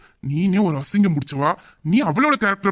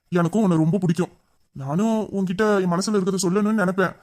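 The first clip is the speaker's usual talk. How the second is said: ordinary talk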